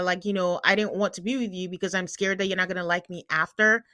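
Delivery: normal talking voice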